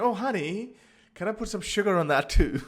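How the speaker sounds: high, sugary flirt with a laugh; flirty husband. Retold or imitated. Husband sweet-talking his wife, high and flirty. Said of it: "and saying".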